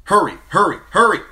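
loud high urgent cry; urgent call. A narrator's reading. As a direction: sound the alarm call. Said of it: "the trumpets called".

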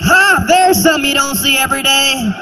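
high, snide remark; sneering locksmith. Pretend. Locksmith seeing a Korean man open a door, snide. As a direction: sneer as he passes by. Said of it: "he's like".

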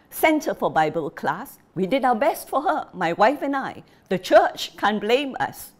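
put-on an angry old father justifying himself to a guest; aggrieved father. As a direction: argue your case, blaming the wife. Father pleading his case angrily.

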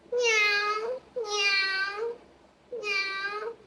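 meowing like a cat; high meow, cat sound. Shown as a demonstration.

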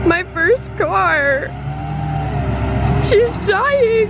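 high, loud, drawn-out fake lament; mock wailing. Owner watching her first car get towed away, mock wailing. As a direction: wail in hammed-up grief.

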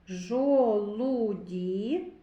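"Жолуді" very slowly stretched through every sound. Shown as a demonstration. Teacher picks out a consonant, "Жолуді" very drawn out. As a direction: draw "Жолуді" out so the л is heard.

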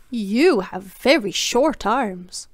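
very slow, deliberate mock compliment; drawn-out compliment. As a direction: stretch every word out sweetly. Teasing Mr Tickle.

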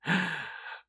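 mocking laugh. Mocking laugh after insult.

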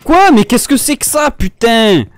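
high, loud disbelief; what on earth is that, gamer rage; shocked outburst. Real, not acted. Shocked reaction to game event.

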